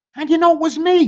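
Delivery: high whiny turkey voice; turkey groaning. Acted. Turkey caught by Pig, high whiny groan. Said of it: "groaned Turkey".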